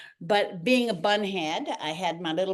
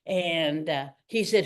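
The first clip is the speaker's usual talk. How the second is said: ordinary talk, nothing marked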